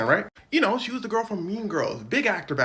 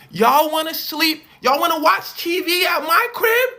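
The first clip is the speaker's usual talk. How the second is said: much higher and louder, stretched coaxing; shrill insistent pitch; 11 semitones higher, 12 dB louder than usual. Retold or imitated. Lindsay pressing the children to come home with her, loud and high. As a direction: oversell it to the kids. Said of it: "She goes".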